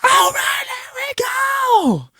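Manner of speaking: super high, drawn-out rock scream. Shown as a demonstration. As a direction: wail it at the top of your range. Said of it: "[name] kind of screams and stuff".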